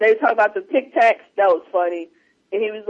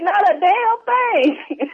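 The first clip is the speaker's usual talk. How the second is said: slow, high mock drawl; 8 semitones higher, much slower than usual. Quoting Smokey's flat reply.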